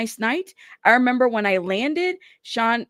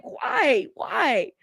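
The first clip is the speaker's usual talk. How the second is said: high-pitched, wailing mock plea; exasperated disbelief; 7 semitones higher than usual. Commentator having a moment over her choice, exasperated. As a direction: plead it twice, pitched way up. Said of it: "I'm having a moment".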